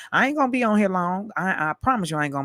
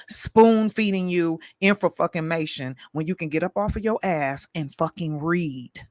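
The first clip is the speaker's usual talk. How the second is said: ordinary talk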